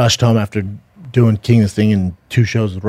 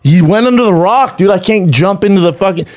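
higher-pitched retold protest; exasperated protest; 8 semitones higher, 3 dB louder than usual. Dad explaining he can't rescue the turtle, exasperated. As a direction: push back helplessly, with rising pitch. Father protests to crying son.

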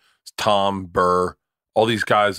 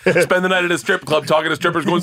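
ordinary talk, nothing marked

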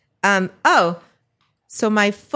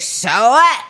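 louder, defiant retort; defiant drunk; 3 semitones higher, 5 dB louder than usual. Retold or imitated. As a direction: throw it back at the cop.

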